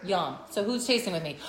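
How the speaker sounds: ordinary delivery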